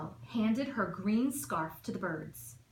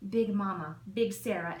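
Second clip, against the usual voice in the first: ordinary delivery, nothing marked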